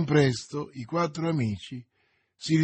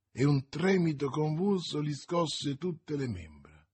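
plain reading voice

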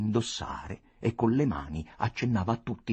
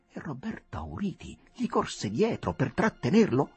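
ordinary delivery

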